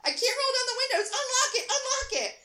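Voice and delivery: high, loud frantic yelling; frantic panic. A narrator's reading. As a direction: yell desperately, urging him to unlock it. Panicked quarrel in car, read aloud.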